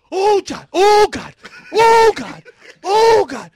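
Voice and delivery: loud, high-pitched terrified cries to God; panicked screaming. Retold or imitated. Man reenacting his earthquake panic, screaming. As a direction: wail it out in full hammed-up panic. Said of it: "I'm screaming".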